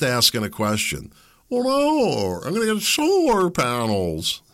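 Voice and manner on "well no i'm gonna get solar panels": high mocking voice of a smug neighbor; mock smug reply, pitched high; smug know-it-all. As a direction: lampoon a self-satisfied answer, pitched way up. Mocking a smug prepper answer.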